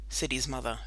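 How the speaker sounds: plain reading voice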